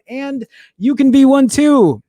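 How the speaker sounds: high, loud salesy pitch; marketing voice. Pretend. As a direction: sell it like an ad. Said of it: "That's my marketing thing".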